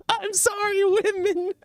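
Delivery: very high squeaky voice; squeaky high apology. Pretend. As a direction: squeak it out in falsetto. Mock apology to women.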